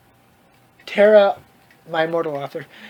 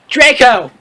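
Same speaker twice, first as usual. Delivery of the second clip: loud hammed-up call of the name; mock dramatic call; mock cry out; 4 semitones higher, 5 dB louder than usual. Reading fanfic dialogue, mock cry.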